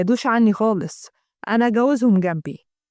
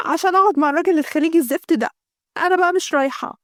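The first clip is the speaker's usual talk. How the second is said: higher, rising indignation; indignant refusal; 5 semitones higher than usual. Acted. Girl tells her sister she refuses, indignant.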